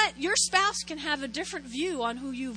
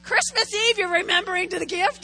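annoyed; 4 semitones higher, 6 dB louder than usual. Retold or imitated. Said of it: "I was like".

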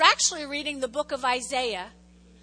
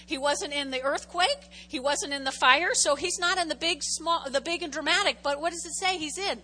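ordinary delivery

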